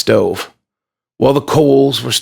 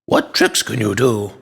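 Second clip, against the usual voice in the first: put-on child putting on a deeper voice; a slightly deeper toy voice, lightly done; deeper play voice. A narrator's reading. Bella voicing Mister Jones for her toys, mildly deeper voice. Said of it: "in a deeper voice she added".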